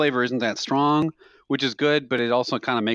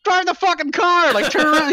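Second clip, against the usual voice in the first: much higher and louder, frantic shout; panicked yell; 7 dB louder than usual. Retold or imitated. Passenger yelling at the reckless cabbie, frantic shout. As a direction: yell at the driver to watch the road. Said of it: "I'm just, like, screaming".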